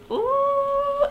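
high excited ooh; excited squeal. Real, not acted. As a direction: squeal with delight.

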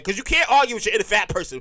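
ordinary delivery

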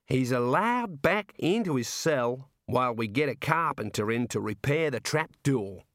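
drawn-out, cheerful Australian jailer; jovial Aussie warder. Acted. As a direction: play a chummy outback prison guard. Warder explains hanging tradition.

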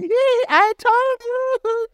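very high, slow, drawn-out mock mom; mom's voice. Mom coming from the room laughing at her crying son, high gloating voice. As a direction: sing it like a gloating mother.

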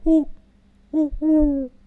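high imitated owl hoots, each dropping at the end; falling owl hoots. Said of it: "end his hoots on a lower tone".

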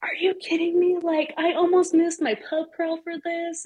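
high-pitched exasperated disbelief; mock outrage. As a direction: squeal it like a ruined weekend. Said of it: "And I was like".

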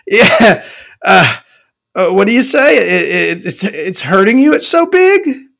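high, loud gloating questions; smug mock taunt. Taunting his hookup about his size, loud and high. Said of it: "and I'm like".

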